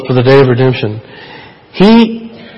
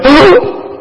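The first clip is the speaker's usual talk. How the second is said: mock gasp; 13 semitones higher than usual. Mock shock that Satan quotes scripture.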